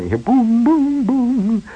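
high ringing boom boom of bells; imitating bells. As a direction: chime the booms up high like Swiss bells.

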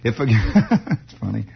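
low chuckle breaking into the words; amused laugh. Real, not acted. Host amused by his accidental impression, laughing mid-phrase. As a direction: crack up at your own slip.

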